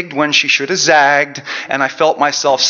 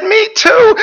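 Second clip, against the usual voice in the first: shrieked reply, extremely high and loud; hooting with laughter; 6 dB louder than usual. Acted. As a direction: squeal it through acted hysterics. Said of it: "we laughed so hard we gasped and wept".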